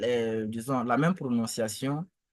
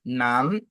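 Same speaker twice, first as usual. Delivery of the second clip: "Nan" gliding upward; rising model "Nan"; second tone; 3 semitones lower than usual. Shown as a demonstration. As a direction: start "Nan" low and let it climb. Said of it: "c'est le deuxième ton".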